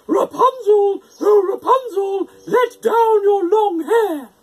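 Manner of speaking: high, loud, slow singsong witch call. A narrator's reading. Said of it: "called out".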